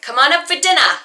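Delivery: put-on high, loud Boston-accented holler; mother's yell. Mother calling her husband to dinner from upstairs, yelling. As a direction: yell down the stairs, dropping the R's. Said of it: "hear my mother yell down the stairs".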